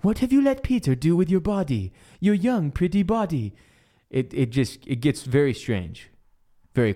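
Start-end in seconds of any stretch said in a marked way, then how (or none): 0.0-3.5 high-pitched, unravelling mock interrogation; hammed-up berserk aunt. Jealous aunt confronts her niece, hammed-up and berserk. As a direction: act out an unhinged aunt, pitched way up. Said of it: "become unraveled and just go berserk".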